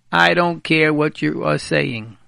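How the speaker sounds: slow and loud, spelling out what he means. Retold or imitated. Rabbi spells out to the men what a husband's "don't worry" means, slow and loud. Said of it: "Don't worry" means".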